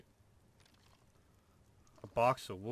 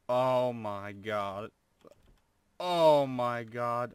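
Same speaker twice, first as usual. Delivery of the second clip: slow, drawn-out and louder exclamation; stunned awe; 10 dB louder, much slower than usual. Real, not acted. Gamer staring at huge loot pile, slow loud awe. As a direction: stretch it out, overwhelmed by the loot.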